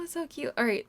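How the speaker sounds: normal talking voice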